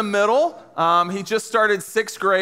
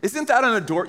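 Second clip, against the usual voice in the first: ordinary talk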